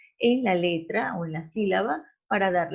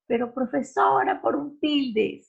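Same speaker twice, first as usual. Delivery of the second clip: complaining student; 6 semitones higher, 3 dB louder than usual. Retold or imitated. Teacher imitating a student protesting to the profesora. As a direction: whine it like a pupil who thinks it doesn't matter. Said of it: "a mí me decían".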